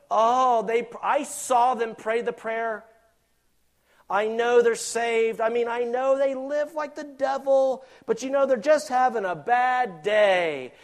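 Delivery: loud, high, slow mocking of the gullible churchgoer; preacher mocking a gullible churchgoer, hammed-up; mock naive believer. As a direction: ham up a naive believer defending a convert.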